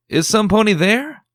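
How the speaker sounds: high, loud searching call; loud and high, reaching across the clearing. Acted. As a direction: call out to someone hiding nearby. Calling to hidden crying pony.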